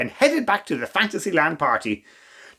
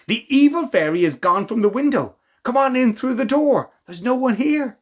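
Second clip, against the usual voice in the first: urgent; 7 semitones higher than usual. Acted. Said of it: "said Rose".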